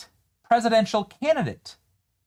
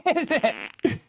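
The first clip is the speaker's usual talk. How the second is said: high, laughing what-is-that; laughing disbelief; slower than usual. Real, not acted. Host reacts to the clip, laughing in high disbelief. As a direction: crack up in disbelief.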